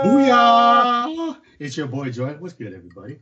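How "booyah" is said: big high booming call; hyped shout. Acted. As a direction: burst in like a hype man. Co-host's hyped entrance call.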